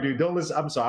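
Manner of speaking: usual speaking voice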